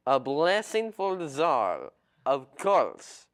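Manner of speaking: Tevye-style Russian accent, slow and loud. Shown as a demonstration. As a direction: bless the Tsar in a heavy Russian accent. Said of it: "go ahead and show us a little bit of that".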